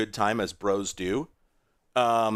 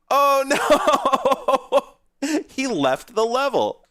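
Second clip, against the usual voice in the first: amused alarm; 14 semitones higher, 6 dB louder, much slower than usual. Real, not acted. Character walks out of level.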